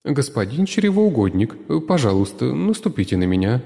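put-on tomato girl asking the master to step on her; tomato murmur. A narrator's reading. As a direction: ask him gently. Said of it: "пробормотала красная".

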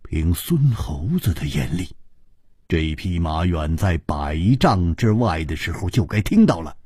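low, sceptical challenge; cold sneer. A narrator's reading. Ji Bingyan doubts the tale of the horseman, cold sneer. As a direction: pick his story apart coldly, voice mostly low. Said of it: "冷笑着说".

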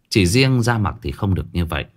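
ordinary delivery, nothing marked

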